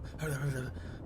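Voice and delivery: soft, garbled mock mumbling; imitated rambling mumble. Mocking a rival's meth-mouth rambling, soft wordless mumble. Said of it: "He would just meth mouth, ramble".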